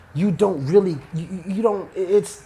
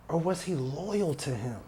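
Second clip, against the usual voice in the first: hushed answer; 7 dB quieter than usual. Shown as a demonstration. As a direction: let or was he loyal to him fall away quietly. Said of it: "repeated question".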